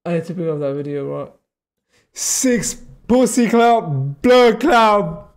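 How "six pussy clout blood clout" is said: shouted, high mock Jamaican voice quoting a viral clip; imitating a viral Jamaican rant, loud and slow; loud Jamaican accent. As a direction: belt it out like the woman in the video, laughing. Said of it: "That was hilarious".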